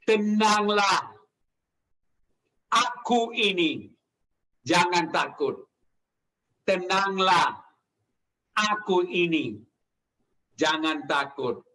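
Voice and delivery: slow words with long pauses, falling low; calm reassurance. Retold or imitated. Preacher voices Jesus to frightened disciples, slow calm reassurance. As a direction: reassure slowly, letting each phrase settle.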